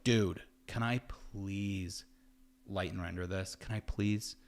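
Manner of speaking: slow, soft pleading ask; drawn-out imitation of himself begging; mock begging. Asking a friend to light his project, mock begging. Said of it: "I, like, begged him".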